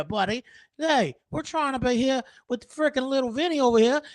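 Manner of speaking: mock Italian mobster. Mocking friend's bad breath.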